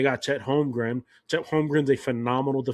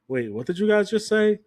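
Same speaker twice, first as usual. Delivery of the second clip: retold disbelief, pitched high; mock double-take at what was heard; high, questioning pitch of someone taken aback; incredulous; 8 semitones higher than usual. Retelling his disbelief at barracks debate.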